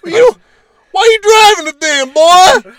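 hammed-up hillbilly yell. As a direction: yell it like an outraged truck guy. Mocking redneck trucker shout.

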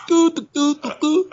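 high, cartoonish "doo doo doo"; sing-song goofy smashing sounds; mock bumbling doo-doo noises. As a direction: bumble along in silly high doos. Repeats the mocking smashing noises.

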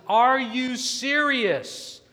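high, loud, drawn-out disbelief; shocked yell. Retold or imitated. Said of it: "yells out loud and says".

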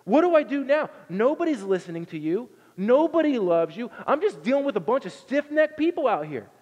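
high, loud pleading cry to God; a leader at his wits' end, yelling; mock frantic complaint to God; desperate Moses. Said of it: "he's like".

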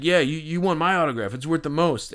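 a hint of a drunk braggart; mock boastful stranger. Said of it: "this guy, like, leans over to me".

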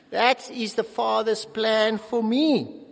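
ordinary talk, nothing marked

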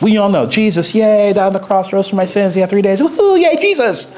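high, hammed-up cheering; mock cheer. Preacher mock-cheering the familiar Easter story. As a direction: cheer it like a pep rally.

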